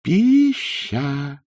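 long, pondering drawl; drawn-out word. A narrator's reading. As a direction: stretch each vowel out slowly. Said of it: "протянул приезжий".

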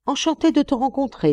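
high-pitched, delighted greeting; exclaiming. A narrator's reading. Cheerful friend meeting the miner on the landing, exclaiming. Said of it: "s'écria-t-il".

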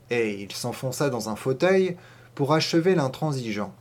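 plain reading voice

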